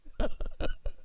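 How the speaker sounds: high unvoiced wheezing laugh; squeaky laugh burst. Real, not acted. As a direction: let a squeal of laughter escape.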